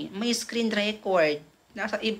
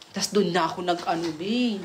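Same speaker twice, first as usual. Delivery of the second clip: ordinary delivery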